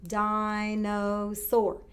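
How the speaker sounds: "Dinosaur" slowly split into its three syllables; syllables tapped out. Shown as a demonstration. Teacher tapping syllables with the class, slow and even. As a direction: tap out "Dinosaur" one beat per syllable. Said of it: "let's tap that one together".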